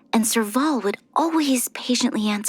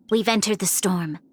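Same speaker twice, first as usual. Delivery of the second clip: usual speaking voice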